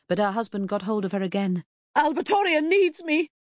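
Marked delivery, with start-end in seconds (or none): 1.9-3.3 high, loud protest; frantic demand. A narrator's reading. Wife struggling in husband's grip, frantic and loud. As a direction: cry out to be released. Mother fights to reach dead daughter.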